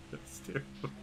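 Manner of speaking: breathless, laughing through the words; can't keep a straight face. Real, not acted. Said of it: "I can't even say that with a straight face".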